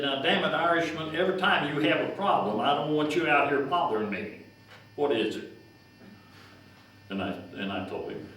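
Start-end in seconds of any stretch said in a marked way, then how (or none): 0.0-5.5 put-on higher and louder, an irritated old farmer telling him off; gruff scolding. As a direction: scold him like a grumpy boss. Gruff boss scolding the agent.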